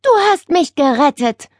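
high, grateful relief; relieved. A narrator's reading. Nele to the aunt who rescued her, relieved. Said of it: "stöhnte Nele erleichtert".

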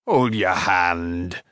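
low, drawn-out amused smith; chuckling. A narrator's reading. Smith halting the bouncing boy, mildly amused. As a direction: say it low and slow, faintly amused. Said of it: "chuckled Karl".

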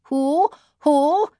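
high, rising owl hoot; surprised owl. A narrator's reading. Owl crying from a sloe tree, surprised high hoot. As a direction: hoot it like a startled owl, pitch sliding up. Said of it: "asked the owl".

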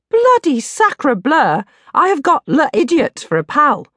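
exasperated Franglais accent, played for laughs; a teen's jokey fake French accent; mock French. Narrator's mock-French outburst.

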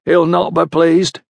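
a hint of a weary old farmhand woman; older countrywoman. A narrator's reading.

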